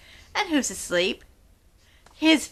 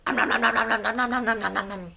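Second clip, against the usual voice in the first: munching sounds; much faster than usual. Pretend. Eating through candy factory.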